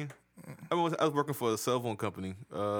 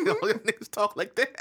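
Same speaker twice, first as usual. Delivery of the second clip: laughing retort; 9 semitones higher, 5 dB louder than usual. Retold or imitated. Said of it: "And I was like".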